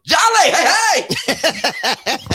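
high, loud call on hey; hype man shout. Retold or imitated. Retelling church hype man's response.

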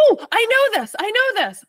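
gasping, high and loud recognition; excited. Real, not acted. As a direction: burst out knowing the answer. Recognizing the trivia answer.

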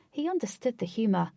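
ordinary delivery

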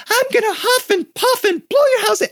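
very high, squeaky comic wolf; surprising, playful high-pitched wolf; silly wolf voice. Shown as a demonstration. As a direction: squeal the threat in a tiny falsetto. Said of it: "sometimes the wolf is really".

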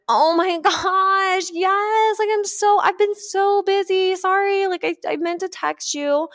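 over-the-top sorry from a busy friend; gushing apologetic friend. Retold or imitated. Friend texting back apologising, high bubbly gush.

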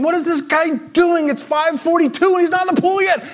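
mock exasperation; irritated inner voice. Retold angry inner thought.

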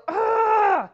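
loud, high wordless yelp; sudden groan of hurt, loud and high; cry of pain. Shown as a demonstration. Preacher demonstrating banging a leg on a table, loud pained cry. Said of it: "I cried out".

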